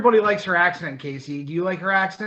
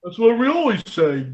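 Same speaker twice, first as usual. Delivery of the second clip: mock Dundalk local. Mocking Dundalk locals online, in a thick dumb accent. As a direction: play a humorless local who misses the joke. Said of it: "dumb Dundalk fucks who were like".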